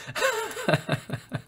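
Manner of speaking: soft, high-pitched giggle; light laugh, high and quiet. Real, not acted. Host joking about distracted driving, high soft giggle.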